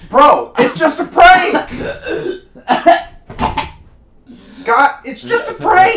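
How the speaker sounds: high, loud, slow scream; panicked shout. Acted. Prank gone wrong, panic.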